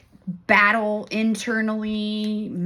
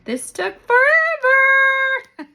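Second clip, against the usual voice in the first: high, loud, stretched-out forever; a sing-song drawn-out complaint about how long it took; 9 dB louder, much slower than usual. Acted. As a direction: drag the words out in mock exasperation. Joking about her long delay.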